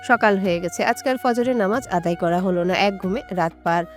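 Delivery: usual speaking voice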